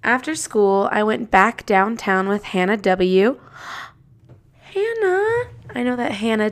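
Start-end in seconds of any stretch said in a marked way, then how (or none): 4.0-5.5 excited gasp. Real, not acted. Podcaster finds her friend in the diary, delighted. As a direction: gasp then a much higher delighted Hannah.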